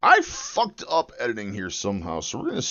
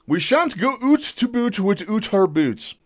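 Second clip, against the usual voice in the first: high, hammed-up Canadian accent; silly exaggerated Canadian; mock Canadian accent; 10 semitones higher than usual. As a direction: turn every "out" into a Canadian "oot". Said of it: "I can speak some Canadian".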